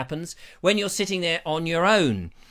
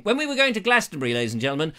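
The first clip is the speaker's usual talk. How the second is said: ordinary talk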